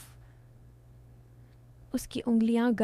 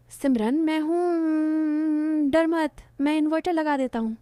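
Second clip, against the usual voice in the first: calling through the door; 5 semitones higher, 3 dB louder than usual. Acted.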